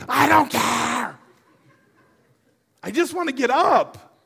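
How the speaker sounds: loud, slow, stubborn complaint; patient arguing back, slow and loud; frustrated retort. Retold or imitated. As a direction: drag it out like a fed-up patient. Acted quarrel, his reply.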